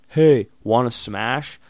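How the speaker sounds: drawn-out imitation of a fuckboy asking to hook up; mock teen come-on. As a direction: sneer at how teens ask it. Imitating teens who say Smash.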